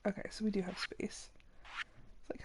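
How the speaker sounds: fast, breathy whisper to herself; hushed quick whisper. Real, not acted. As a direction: whisper it while counting space. Murmuring while checking inventory.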